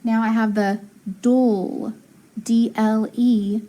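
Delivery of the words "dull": dull stretched as a lone syllable; syllable sound. Shown as a demonstration. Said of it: "final syllable".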